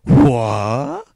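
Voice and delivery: loud, incredulous outburst; disbelieving. Real, not acted. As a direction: blurt it out in disbelief. Startled by co-host's prediction.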